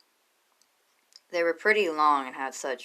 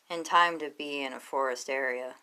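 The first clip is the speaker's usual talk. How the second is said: ordinary delivery, nothing marked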